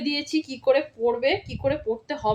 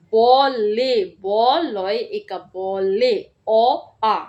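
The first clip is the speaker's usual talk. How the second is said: slow spelling out; 3 semitones lower, 4 dB louder, much slower than usual. Shown as a demonstration. Teacher spelling out a word slowly for first graders. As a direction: spell each letter slowly and clearly. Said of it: "আরেকবার বলে দিচ্ছি কিভাবে পড়তে হবে".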